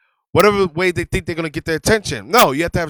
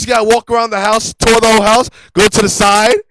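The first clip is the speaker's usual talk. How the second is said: usual speaking voice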